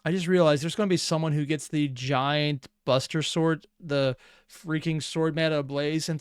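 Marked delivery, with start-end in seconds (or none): none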